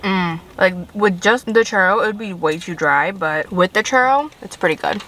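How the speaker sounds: talking while chewing; muffled with food in mouth; mouth full. Real, not acted. Seen 0: Bit churro at -1.0; lips closed, chewing while talking after.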